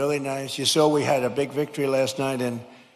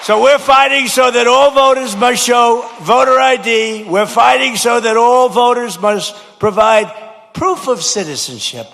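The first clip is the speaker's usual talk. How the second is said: loud, higher campaign pledge; rally shout; 8 semitones higher, 9 dB louder than usual. Real, not acted. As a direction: hammer the promise home.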